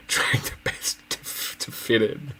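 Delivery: slow, breathy half-whisper through giggles; laughing through it. Real, not acted. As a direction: let the laugh take over the words. Repeats phrase, breaking into laughter.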